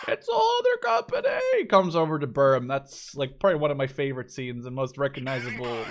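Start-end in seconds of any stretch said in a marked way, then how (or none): 0.1-1.6 high, strained mock panic cry; the famous war film line, high and alarmed; Band of Brothers quote. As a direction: squeak it out like a panicked soldier. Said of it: "it's like".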